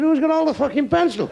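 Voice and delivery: high-pitched pupil's protest; mock indignant outburst. Pupil to teacher after the pencil drill, mock indignant. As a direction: burst out high in mock outrage.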